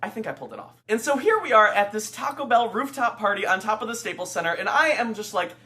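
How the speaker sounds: normal talking voice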